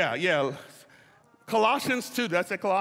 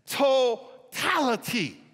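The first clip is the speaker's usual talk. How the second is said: "totality." slow, stretched and called out; emphatic totality; much slower than usual. Real, not acted. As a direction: ring out "totality.". Climax of definition.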